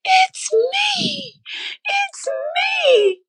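high, crying shout of joy; shouting to the pastor across the sanctuary, crying out; screaming. Retold or imitated. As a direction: scream it out, overcome with relief. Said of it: "I'm screaming".

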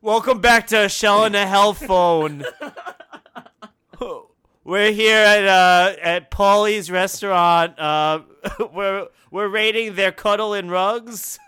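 put-on loud TV-host Baltimore accent that drifts to Philly; hammy Baltimore accent, really Philly. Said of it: "your Baltimore is just Philly".

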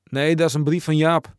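loud, indignant comeback; emphatic snapped denial; sharp retort. A narrator's reading. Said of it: "ketste Eddy terug".

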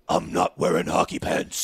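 low, slow Batman voice. Acted. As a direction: drop way down for a mock-heroic movie line.

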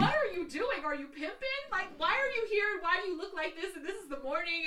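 loud, high barrage of baffled questions; retold disbelief, volume and pitch way up; utterly confused. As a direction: pelt him with bewildered questions. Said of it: "I'm just so fucking confused".

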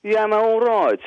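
quick Birmingham accent sample on request; Brummie accent. Shown as a demonstration. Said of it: "the Birmingham accent's like that".

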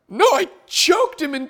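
high, loud imitation of an angry son; pissed-off kid. Angry son answering mom on camera, mock outraged squeal. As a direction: protest in an outraged child's voice. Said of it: "my son gets even more pissed".

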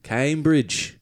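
exaggerated British place-name, falling; mock British accent, drawn down at the end; unplaceable British accent. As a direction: say it in a dubious put-on British accent. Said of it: "I don't know what accent that is".

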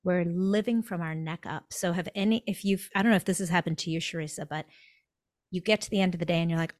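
usual speaking voice

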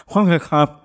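name mumbled through food; mouth full. Pretend. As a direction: say it around a spoonful. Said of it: "my mouth is full".

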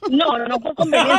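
laughing denial. Real, not acted. Caller laughing as she denies doing it for convenience. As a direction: laugh it off while protesting.

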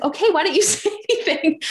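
high, fast, laughing bewilderment; laughing puzzled partner. Retold or imitated. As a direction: mimic him, cracking up mid-question. Partner's retold puzzled reply.

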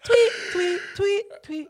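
very high chirping tweets; parakeet chatter. Retold or imitated. Repeating the high tweet vocal.

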